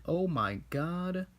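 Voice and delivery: a kid voicing a scared villain; mock panic. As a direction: play-act the villain's fright, stretched out. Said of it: "And Tai Lung's like".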